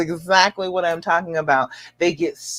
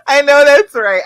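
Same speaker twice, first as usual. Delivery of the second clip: laughing agreement; 8 semitones higher, 8 dB louder than usual. Real, not acted. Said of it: "therapy sessions".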